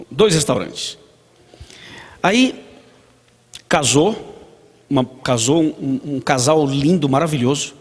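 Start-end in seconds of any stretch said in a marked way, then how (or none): none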